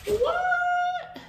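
high squeaked shocked what. Real, not acted. Unboxing a surprise gift, high shocked squeak. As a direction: gasp out the what in disbelief.

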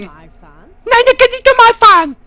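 shrieking high falsetto, loud; piercingly high parody of a nag; mocking passenger imitation. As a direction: screech back the complaint in mockery. Mocks complaining passenger.

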